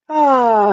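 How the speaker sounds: loud falling sigh from high pitch; voiced out-breath. Shown as a demonstration. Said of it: "let it out with a sound".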